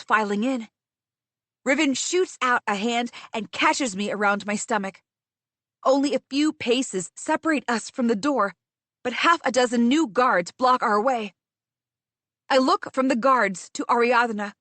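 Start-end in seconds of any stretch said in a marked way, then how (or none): none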